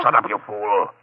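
loud furious hiss; angry snap. Acted. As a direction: cut her off in rage. Villain silences accomplice.

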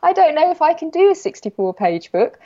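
high, fretful admission of avoiding it; panicked confession. Retold or imitated. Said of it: "I was like".

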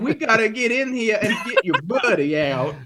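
high, hammed-up New York accent; put-on New York accent. Digger urging Eddie to help, in a hammy New York accent. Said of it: "sand roll for [name]'s accent".

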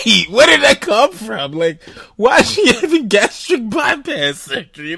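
high, loud bafflement breaking into laughter; loud, squeaky disbelief; incredulous reaction. Retold or imitated. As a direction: replay your shock, cracking up. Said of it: "'cause I'm like".

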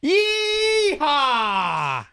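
loud cowboy yeehaw shout, pitched high. Pretend. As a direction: whoop it out like a rodeo cowboy. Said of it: "drop it for me".

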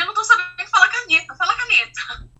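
a flustered wife begging her husband to say caneta; desperate plea. Retold or imitated. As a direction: urge him to say the word for you.

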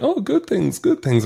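high-pitched imitation of a reassuring reply; squeaky-high retold "good things"; mock reassurance. Mimics evasive reply about him.

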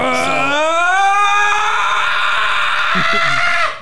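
long loud burp into a scream. Real, not acted. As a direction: belch then scream it out. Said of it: "I had to burp and scream".

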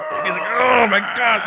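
high, slow strained groan of effort; straining. Acted. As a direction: strain as if lifting a heavy man. Straining to pull friend up.

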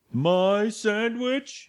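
high, drawn-out mock outrage; Ross impression. Friends fan imitating a psycho over his food, high and slow. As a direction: stretch it out in a high, put-on wail. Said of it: "Ross. Oh, what a psycho".

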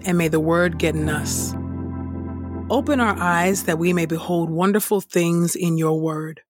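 ordinary talk, nothing marked

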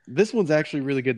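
usual speaking voice

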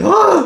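high, loud startled scream. Acted. Ghost Brad jumps and screams in a loud, high shriek. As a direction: shriek in a sudden fright. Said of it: "he screams, he jumps".

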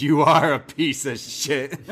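slow, flat-cold retort; mock-stern Bryan Danielson; deadpan insult. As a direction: say it slowly and dead serious. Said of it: "who just turns and goes".

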